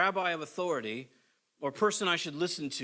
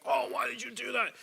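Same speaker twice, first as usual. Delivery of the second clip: mock exasperated snap, pitched up; upset parent; 6 semitones higher than usual. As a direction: imitate a fed-up parent scolding lightly. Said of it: "just been upset about something".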